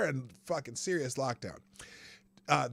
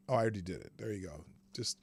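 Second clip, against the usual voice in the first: ordinary delivery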